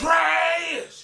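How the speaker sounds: high, very loud, stretched yell; heckling shout. Real, not acted. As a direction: scream the one word long. Heckles over others' threats.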